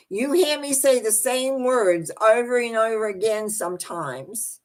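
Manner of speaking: normal talking voice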